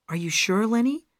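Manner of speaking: high, worried question; anxious woman's voice rising at the end. A narrator's reading. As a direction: lift the pitch anxiously, seeking reassurance. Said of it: "Gail asked anxiously".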